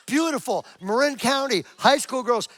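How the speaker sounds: ordinary talk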